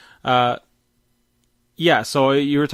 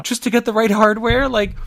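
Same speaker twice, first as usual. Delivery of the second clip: indignant outburst; 11 semitones higher, 6 dB louder than usual. Real, not acted. Outraged at the hardware price.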